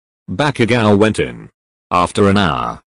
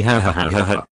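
spelled-out ha ha, softer, barely laughing; read-out laugh; 4 dB quieter, faster than usual. Acted. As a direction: break into a small read-out giggle. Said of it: "I can't do it anymore".

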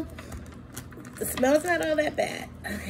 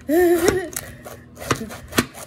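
laughing; 6 semitones higher, 4 dB louder than usual. Real, not acted. Laughs at her own struggle.

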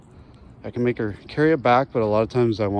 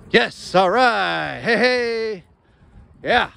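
high, slow, drawn-out cheer; excited dog praise; 11 semitones higher, much slower than usual. Real, not acted. Owner cheering his dog's catch, high and excited. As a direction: hype the dog up.

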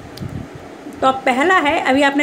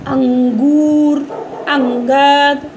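drawn-out words, a bit louder, for the class; slow, loud example words; 2 semitones higher, 4 dB louder, much slower than usual. Shown as a demonstration. Teacher asking pupils for examples, slow and loud. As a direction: stretch each word out clearly. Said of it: "अनुस्वार का".

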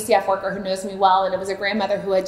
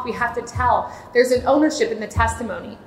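ordinary talk, nothing marked

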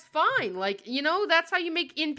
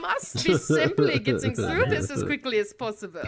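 theatrical put-on drow villain voice; drow antagonist; 2 semitones higher than usual. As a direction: play a drow villain with an affected voice. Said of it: "drow antagonist from the first adventure".

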